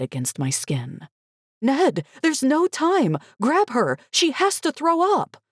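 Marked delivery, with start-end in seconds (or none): 1.6-5.4 high, slow desperate pleading; urgent and panicked; frantic. A narrator's reading. Mother urging husband to grab the girl, frantic.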